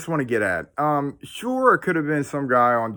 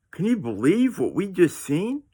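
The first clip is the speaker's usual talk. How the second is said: higher, altered voice of stunned disbelief; 5 semitones higher than usual. Retold or imitated. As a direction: say it like you still can't believe it. Repeats question in altered voice.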